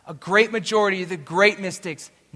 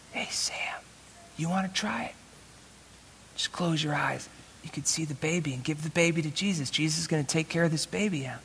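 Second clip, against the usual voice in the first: soft, hushed retold coaxing; gentle coaxing; 9 dB quieter than usual. Father coaxes his son to close his eyes, gentle coaxing. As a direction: quietly talk a child into trying.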